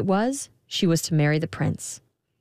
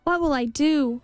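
calling out; 8 semitones higher than usual. A narrator's reading. Girl calls out to the air, helpless. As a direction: call out helplessly to nobody. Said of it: "she called out to the air".

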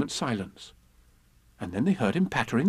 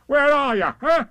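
high, loud searching call; boisterous high-pitched shout; Tigger calling; 8 dB louder than usual. A narrator's reading. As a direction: holler for friends lost in the mist. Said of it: "called Tigger".

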